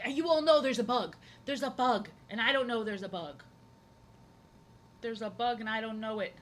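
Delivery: a hint of a raving crazy person. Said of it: "sounds like a schizo".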